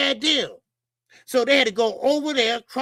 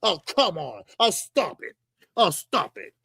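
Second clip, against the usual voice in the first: a hint of Blue Blood's lower voice; Blue Blood impression; 5 semitones lower than usual. Commentator mimics Blue Blood telling someone to quit, lightly lowered voice. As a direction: lightly imitate him, pitched down. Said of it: "In, the, in my blue blood, uh, uh, uh, uh, voice".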